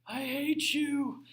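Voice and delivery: hammed-up bitter king, drawn out and high; sulky defeated king. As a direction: pout it out like a sore loser. Defeated Assyrian king wakes up.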